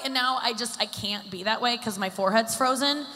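ordinary talk, nothing marked

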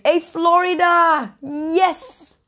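high, slow, drawn-out hello; excited greeting. Real, not acted. Greeting viewers from Florida, high and delighted.

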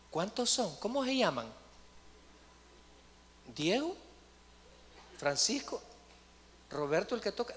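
mock-curious small talk; mock interviewer. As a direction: chat up a demon as if taking names. Mock chat with the demon.

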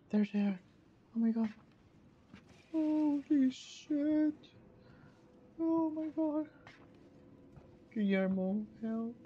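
high frightened gasps; startled and scared; scared gasping. Real, not acted. Jumpscare, monsters spotted.

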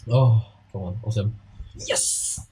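ordinary delivery

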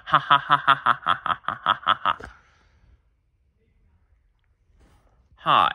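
evil laugh. Acted. Bully gloats after threatening Kyrie.

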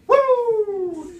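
high, loud "woo"; imitated whoosh. Storyteller shows the muskrat bolting, high loud whoop. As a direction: sing out a high whooping zoom.

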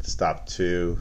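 ordinary talk

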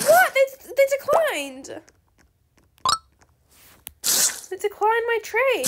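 can't believe it happened; stunned outrage at a refused trade; shocked. Real, not acted.

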